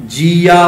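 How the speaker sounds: ਜੀਆ sung as jee-aa, the ending dragged into aa; wrong reading. Shown as a demonstration. Teacher showing how people wrongly read ਜੀ as ਜੀਆ. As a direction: chant ਜੀਆ the mistaken way. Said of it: "ਅਸੀਂ ਪੜ੍ਹ ਦਿੰਦੇ ਹਾਂ".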